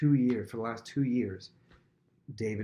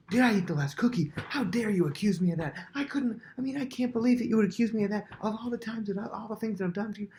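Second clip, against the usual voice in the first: much higher, louder hammed-up indignation; outraged aggressive friend; 12 semitones higher, 4 dB louder than usual. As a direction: act hurt and furious to dodge the question. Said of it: "aggressive person makes a big aggressive show".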